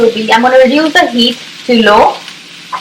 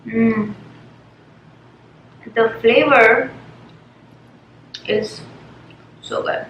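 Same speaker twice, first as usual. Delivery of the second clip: eating crunchy fried chicken; 7 dB quieter, slower than usual. Real, not acted. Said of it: "let me just dig in and take a bite".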